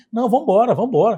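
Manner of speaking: loud "let's go", cutting it short; loud demand to leave. Retold or imitated. Sister telling her twin to leave the office, loud.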